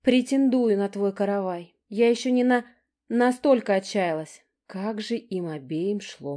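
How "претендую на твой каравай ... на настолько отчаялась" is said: catty retort. A narrator's reading. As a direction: lay each word on like a barb.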